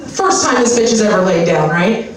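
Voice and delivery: ordinary talk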